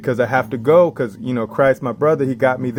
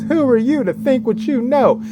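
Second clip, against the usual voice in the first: high mocking challenge; mocking skeptic; 12 semitones higher than usual. Imitating a doubter challenging him, high and scornful. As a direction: squeak out a scornful put-down.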